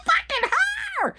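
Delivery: mock shriek, shooting up very high; hammed-up table-flip scream; screaming like banshee. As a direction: build from high demand to screech. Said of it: "screaming like a banshee".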